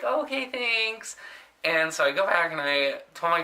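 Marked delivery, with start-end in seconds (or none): 0.0-1.2 high, small startled voice; meek fake thanks. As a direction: back off with a squeaky okay. Cowed reply after snapping.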